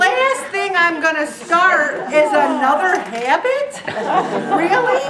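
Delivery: a scoffing squeak of disbelief; incredulous. Real, not acted. Mocking the idea of another habit.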